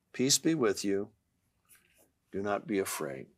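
low, hushed blessing; gentle reassurance. Acted. Jesus calming a frightened father, gentle reassurance. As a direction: settle down soft and low.

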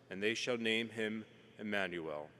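ordinary delivery

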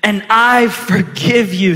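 loud, slow proclamation of pardon; emphatic declaration. Acted. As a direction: proclaim it boldly, drawing out the words. Joseph's forgiveness declared to the church.

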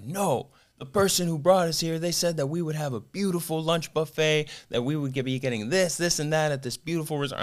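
high imitated complaint voice; pissed-off guests. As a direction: pitch it up as the aggrieved customer. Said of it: "they're all still pissed".

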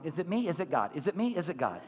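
soft, see-sawing questions; mock hesitant muttering to oneself; wavering doubt. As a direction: alternate up and down, quietly unsure. Imitating doubters' inner wavering.

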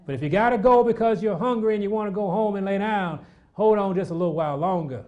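high, loud urging; fervent preacher pleading; pleading exhortation. Acted. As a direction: hold them in their seats. Exhorting hungry congregants.

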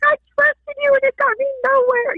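high-pitched tearful tantrum, re-enacted; hammed-up crying protest; crying fit. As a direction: wail the accusation at God. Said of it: "I am crying and I am pitching my fit".

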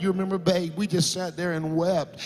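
retelling the milk crate conversion with rising passion; rising preaching. Acted. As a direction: preach it with growing fervour. Preacher builds the sermon story.

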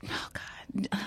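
breathless whispered aside; a small embarrassed whisper; whispered oh god. Real, not acted. Embarrassed to give her birthday.

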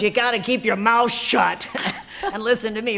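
mock tough coach. Stunt boss telling a newcomer to listen, mock stern. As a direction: bark it like a tough stunt coordinator, grinning. Stunt coordinator's gruff order retold.

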